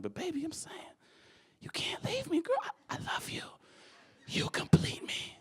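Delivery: high, breathy, desperate pleading; pleading lover whisper. Retold or imitated. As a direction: beg her like a movie romantic. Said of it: "I'm like".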